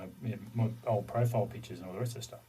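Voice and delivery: normal talking voice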